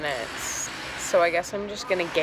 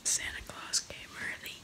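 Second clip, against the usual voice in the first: hushed whisper so nobody wakes; 17 dB quieter than usual. Real, not acted. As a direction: whisper softly, family asleep. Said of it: "I have to whisper".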